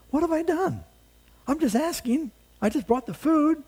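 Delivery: put-on a kid wronged, all wide-eyed; innocent younger brother. David answering his brother Eliab, high and protesting.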